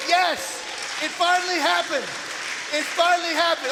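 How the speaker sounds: loud, high, triumphant cheer; real elation. Real, not acted. Speaker rejoices to the room at finding a Herbert, elated. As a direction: celebrate a long-awaited win at full voice.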